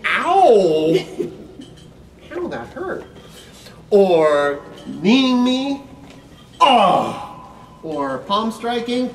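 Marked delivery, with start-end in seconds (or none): 0.1-1.0 hammed-up loud ow; mock pain cry. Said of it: "pretending to be the big bad meanie". 3.9-7.2 mock pain cry. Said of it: "pretending to be the big bad meanie".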